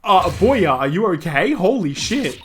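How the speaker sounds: alarmed heroine. Acted. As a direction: rush to a wounded friend. Character finds Apollo hurt.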